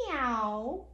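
low, stretched meow rising at the end; drawn-out picture-book meow; cat meow. Acted. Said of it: "Who says meow?".

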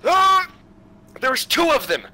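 high panicked cries; panicked yelling. Real, not acted. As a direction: yelp in alarm. Panicking at enemies appearing.

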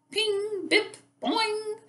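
very high, springy sound words; ping each word up high like a bounce; high bouncing sounds. A narrator's reading. Teacher voices Oyster's pearl bouncing, high springy sound effects.

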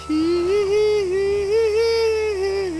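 high falsetto hee hee. Retold or imitated. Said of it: "I legit almost said".

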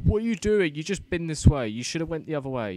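high, loud mock viewer scolding; exasperated viewers. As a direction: play the fed-up viewer yelling at the screen. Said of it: "I bet all of you are now watching this thinking".

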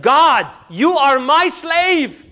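loud, high, slow joyful exclamation; overjoyed cry. Retold or imitated. Preacher retells the man's joyful cry to God. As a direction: call out as if beside yourself with joy.

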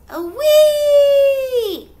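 very high, very loud long howl; wolf howl. Acted. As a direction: throw back your head and howl. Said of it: "so he howled".

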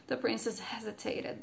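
usual speaking voice

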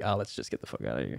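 low, soft, hurried aside; quiet mock resignation; imagined giving up. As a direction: mutter it quickly as if bailing out. Imagining comic giving up on stage.

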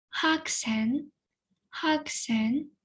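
careful model of хаксен for learners; Korean pronunciation. Shown as a demonstration. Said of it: "я прочитаю по декілька разів слово". (вимова «Хаксен» повільно й чітко, двічі)

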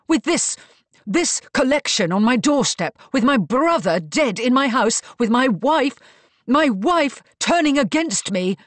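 high, loud, outraged tirade; rant, building on each grievance; angry rant. A narrator's reading. Marcus rails at his household and wife, ranting angrily.

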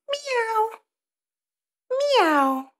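cat meow. Shown as a demonstration. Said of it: "how does the cat sound".

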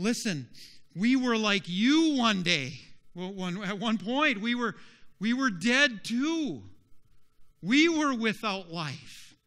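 loud, high-pitched impassioned testimony; crying out. Acted. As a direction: cry out to those still lost. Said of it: "are crying out".

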